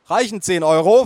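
high, loud question; indulgent mother. Acted. Mother paying boy's toll, indulgent voice. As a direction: ask it as the mother playing along. Mother humours small boy.

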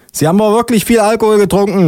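high-pitched accusation; high put-on officious voice; accusing policewoman. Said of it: "ruft die Polizistin".

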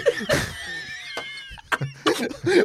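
high breathless shriek of laughter. Real, not acted. Teller bursts out laughing at the story, shriek. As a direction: crack up completely.

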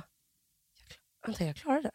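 breathy self-encouraging whisper. Retold or imitated. Coming out of the tube, whispering she made it. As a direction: whisper it to yourself, just for you.

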